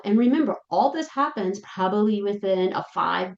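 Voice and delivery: normal talking voice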